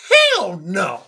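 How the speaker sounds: loud, punched-out mock refusal; emphatic rejection. Woman shooting down a timid suitor, emphatic refusal.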